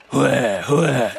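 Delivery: loud driver's call; rough shout to the team; urging horses. Acted. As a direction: crack the command at the horses. Ankou drives horses with whip.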